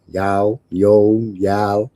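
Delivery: low American y'all, trying it out; half-hearted American accent; mock American accent. Shown as a demonstration. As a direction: try out an American drawl on y'all. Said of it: "Whatever they say".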